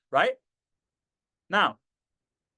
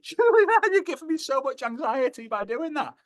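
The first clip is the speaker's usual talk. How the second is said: mock anxious squeal; 11 semitones higher than usual. Scared rider to Julie in the next seat, hammed-up anxious squeal. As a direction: wail at a friend in comic panic. Acts panicking beside friend on coaster.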